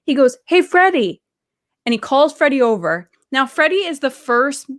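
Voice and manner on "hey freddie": high, loud call to someone nearby; loud, higher hailing call. Retold or imitated. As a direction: wave someone over with the voice. Friend calling Freddie over.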